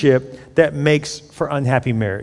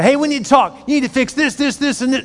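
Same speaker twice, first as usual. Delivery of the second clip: ambush demand; 8 semitones higher than usual. Retold or imitated. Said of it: "Don't just show up and say".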